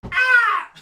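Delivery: high, loud "Ah!"; sudden shouted yelp. Pretend. Reader winding up for the Scottish take, loud yelp.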